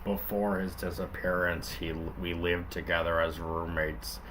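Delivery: lightly voiced hard-boiled cop; Linkara cop voice. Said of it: "I'll just do Linkara".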